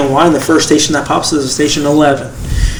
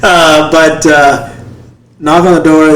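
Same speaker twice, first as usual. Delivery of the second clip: usual speaking voice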